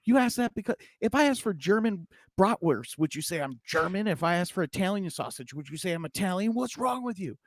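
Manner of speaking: high, outraged joke customer; irate customer. Acted. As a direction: play the offended man in the punchline setup. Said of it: "the man becomes irate".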